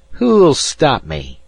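slow, high defiant challenge; deliberate. A narrator's reading. Spoiled boy defying the New Yorker, slow deliberate challenge. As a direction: drag out each word to dare them. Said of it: "he answered deliberately".

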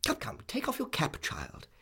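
high-pitched, bossy scolding; no-nonsense aunt voice; brisk Miss Betsy. Acted. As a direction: order the child about briskly.

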